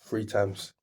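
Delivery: usual speaking voice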